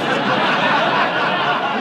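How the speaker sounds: high loud shriek of laughter. Real, not acted. Wild laugh at the story.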